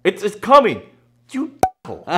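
yelling. Pretend. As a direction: yell it across the room, playfully. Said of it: "do you want me to yell?".

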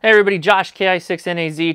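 ordinary talk

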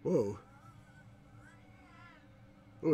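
ordinary talk

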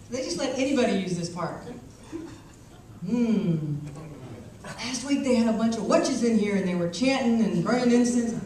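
lightly played chatty old Southern man; lightly voiced old man.

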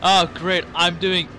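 loud, fed-up sarcasm; sarcastic exasperation. Retold or imitated.

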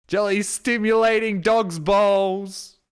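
loud, high, drawn-out mock announcement; mock proclamation. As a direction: proclaim it slowly at high pitch, like a scandal. Mock headline after his bad phrasing.

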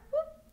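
sudden high hop sound; a bright hop sound for the baby; high whoop. Acted. Frog hops, sound effect.

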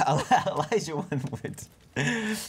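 laughing. Real, not acted. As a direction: laugh your way through the name. Both laughing at the joke.

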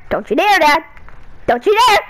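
usual speaking voice

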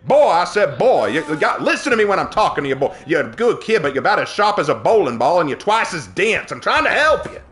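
blustering Southern rooster, swinging high; Foghorn Leghorn. Acted. Cartoon rooster scolding a dense kid, blustering Southern. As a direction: lecture like a loud cartoon rooster with a Southern twang. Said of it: "Foghorn Leghorn is my dad's favorite".

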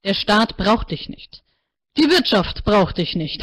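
slow, each word set down firmly; slow blunt verdict. A narrator's reading. Counselor tells Martina she's not needed, slow and firm. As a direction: let each phrase land.

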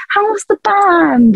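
high, slow, patronising relative; relative at a party. Retold or imitated. Relative at a family party asks about the band, high and slow. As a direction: drawl it out like a nosy aunt. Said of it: "they're like".

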